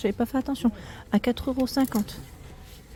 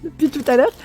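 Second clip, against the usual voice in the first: frog in throat; 4 semitones higher, 5 dB louder than usual. Real, not acted. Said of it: "j'ai un truc dans la gorge".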